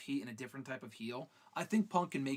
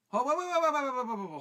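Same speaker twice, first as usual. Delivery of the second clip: much higher and louder stream of waits; frantic yelling; 10 semitones higher, 8 dB louder, faster than usual. Real, not acted. Halting the stream over a viewer comment, frantic yell. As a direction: cut everyone off in a panic. Stopping the show in outrage.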